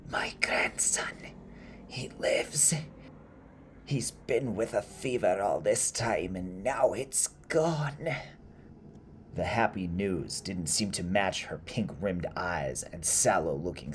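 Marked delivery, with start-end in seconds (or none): 0.1-8.1 whispery sob rising into a high, strained cry; tearful rasp. Acted. Old woman telling of her grandson's recovery, sobbing raspily. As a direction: sob the good news out, drawing out the last words. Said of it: "came out as a sob".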